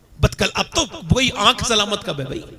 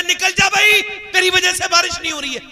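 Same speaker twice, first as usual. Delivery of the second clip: high, raised call to leave; 11 semitones higher than usual. Acted. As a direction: demand he step out. Moses calls out the sinner. (ऊँची आवाज़ में बुलाना)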